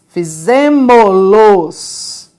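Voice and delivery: Fizemos-los stretched out slowly; slow pronoun demo. Shown as a demonstration. Teacher models the verb-pronoun form for the class. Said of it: "vocês fizeram os exercícios".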